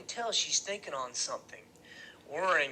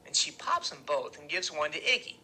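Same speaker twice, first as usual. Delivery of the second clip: usual speaking voice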